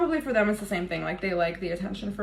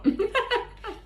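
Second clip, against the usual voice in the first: high laugh; 12 semitones higher than usual. Real, not acted.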